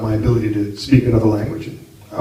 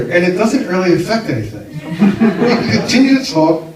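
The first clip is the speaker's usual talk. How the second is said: ordinary talk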